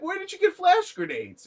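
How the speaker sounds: high, loud squawked question; mock incredulous yell. Retelling a fighter facing flash grenades, incredulous yell. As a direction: squawk it in exaggerated disbelief.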